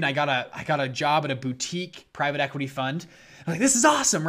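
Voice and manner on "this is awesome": high, loud thrilled outburst; excited. Retold or imitated. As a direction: burst out with delight. Retelling thrilled inner reaction.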